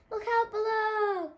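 loud, high, slow call. A narrator's reading. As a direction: call down from above. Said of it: "called Muriel".

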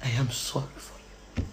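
very soft, low repeat; almost murmur it, trailing off; quiet, deep and fading; hushed pity. Real, not acted.

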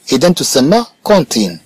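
ordinary talk

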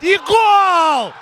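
loud high goal yell; excited goal shout. Real, not acted. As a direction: belt out the goal. Goal scored.

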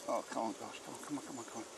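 very soft, repeated urging; soft coaxing. Real, not acted. Beekeeper urging the guys in the hive along, very softly. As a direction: coax gently under the breath. Urging bees under his breath.

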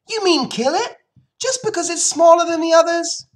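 put-on very high, loud outraged child; shrieked protest. Fern challenges her mother over the runt, shrieking. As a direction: shriek the question in disbelief. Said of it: "shrieked Fern".

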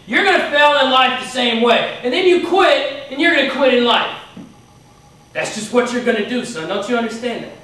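imitating a coach's forceful, insistent dressing-down; stern coach lecture. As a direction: drive each point home like a scolding coach. Retold coach lecturing the quitter hard.

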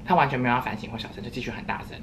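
ordinary talk, nothing marked

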